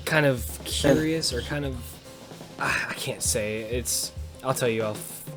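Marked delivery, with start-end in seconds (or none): none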